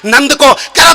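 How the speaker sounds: ordinary talk, nothing marked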